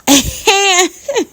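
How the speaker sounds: high-pitched laugh; real laugh. Real, not acted. Woman laughing at her own need for clothes, amused.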